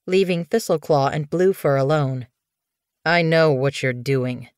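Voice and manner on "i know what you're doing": ordinary delivery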